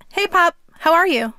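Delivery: almost yelling, but holding back; near-yell into a phone. Retold or imitated. Grandson calling into his phone to a hard-of-hearing grandfather, near-yell. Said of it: "spoke as loudly as I could without full-on yelling".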